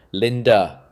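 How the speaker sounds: loud call across the yard. A narrator's reading. Brother calling his sister across the yard, loud. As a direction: call her name out loud across the garden.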